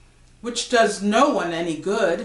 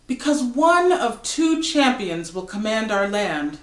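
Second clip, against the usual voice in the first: normal talking voice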